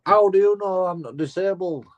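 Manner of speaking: high mocking whine of a caught cheat; sneering impression of a driver faking a limp; mock indignant driver. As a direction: play the caught driver, pitched well up and indignant.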